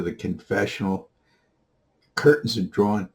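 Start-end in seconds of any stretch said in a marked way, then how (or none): none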